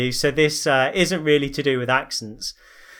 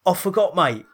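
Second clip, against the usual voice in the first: higher-pitched mock Cockney geezer; Danny Dyer; 5 semitones higher than usual. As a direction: wheedle like an exaggerated London geezer. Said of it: "have to be Danny Dyer".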